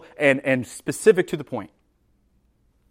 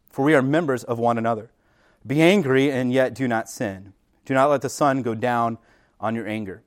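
measured, lower scripture reading; 4 semitones lower than usual. Acted. Pastor reading Ephesians to congregation, measured and low. Reads the scripture passage aloud.

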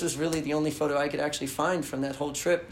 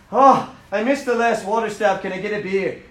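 put-on Boston accent; 4 semitones higher, 8 dB louder than usual. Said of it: "practicing my Boston accent".

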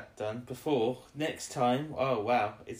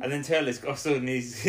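ordinary delivery

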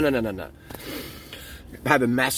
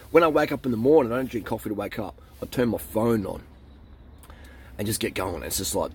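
ordinary delivery

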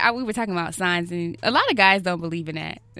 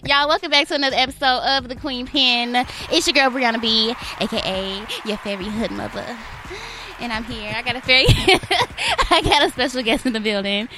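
louder, upbeat show opener; podcast host intro; 5 semitones higher, 7 dB louder than usual. Acted. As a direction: announce the episode like a radio host.

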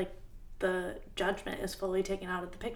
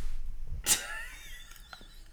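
breathy high laugh; 5 dB quieter than usual. Real, not acted. Embarrassed laugh.

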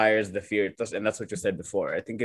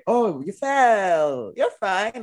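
breezy reassurance; 10 semitones higher, 4 dB louder, much slower than usual. Retold or imitated. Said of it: "you say".

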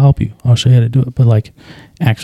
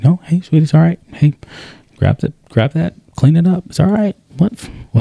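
higher, gentle reassuring voice; calm her down so she won't cry; soothing dad; 6 semitones higher than usual. Retold or imitated. Dad reassuring his little daughter after a spill, gentle.

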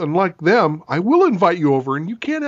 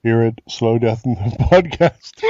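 mock announcer in a glass; hollow glass echo announcer; talking into a glass; 5 semitones lower, 3 dB louder than usual.

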